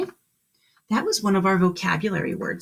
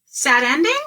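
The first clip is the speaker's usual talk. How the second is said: higher, louder squeaky question; lightly voiced curious mouse; 7 semitones higher, 6 dB louder than usual. A narrator's reading. The mouse asking Duncan, lightly squeaky. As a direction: ask it like a small curious mouse. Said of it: "asked the mouse".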